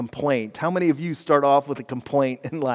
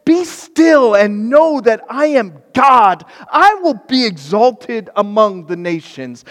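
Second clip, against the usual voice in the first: proclaiming scripture; 7 semitones higher, 9 dB louder than usual. Acted. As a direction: declare it like a herald. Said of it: "Psalm forty-six ten it".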